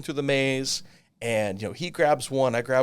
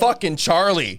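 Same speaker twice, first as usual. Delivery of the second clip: slower, louder grudging exasperation; exasperated grudge; 7 semitones higher, 8 dB louder, slower than usual. Retold or imitated. Telling a friend his rival came back, exasperated grudge. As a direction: spit out the rival's name.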